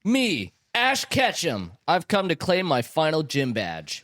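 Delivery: high, slow proud declaration; determined young hero. Acted. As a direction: announce yourself boldly. Ash answers the gym leader.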